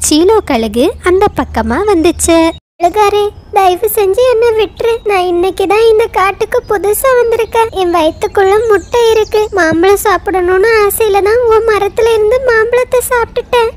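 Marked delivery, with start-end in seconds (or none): 2.8-13.7 high-pitched crow begging for mercy; pleading mother crow. Acted. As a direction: plead up high for your life. Voices captured crow pleading. (உயர்ந்த குரலில் கெஞ்சும் காகம்)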